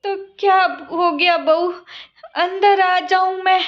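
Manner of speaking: put-on a tired old mother explaining herself; meek old mother-in-law. Old mother-in-law asking to enter.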